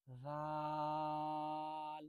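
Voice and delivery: রাল held long, soft and thin; stretched soft letter. Shown as a demonstration. Teacher showing soft stretched letter to students. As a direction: draw রাল out softly. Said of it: "একটু টেনে বলবেন".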